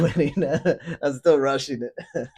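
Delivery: breaking into laughter mid-line; laughing. Real, not acted. Reviewer cracking up at own rushed impression, laughing. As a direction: laugh through the words, voice lifting.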